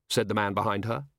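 plain reading voice

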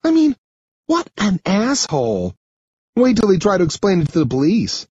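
high, outraged character voice; high-pitched, heated vow; indignant girl. Acted. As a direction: rant about the bully as the girl. Sarah, indignant, in dialogue.

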